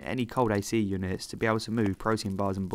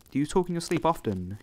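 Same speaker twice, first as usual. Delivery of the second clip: afraid; 6 semitones higher than usual. Retold or imitated. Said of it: "unable to hide her fear in her voice".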